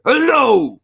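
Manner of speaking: high, loud hammed-up Texan greeting. Streamer imitating a Jerky Boys Texan, hammed-up yell. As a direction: belt it out like a brash cowboy. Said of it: "[name], the cattle wrestler".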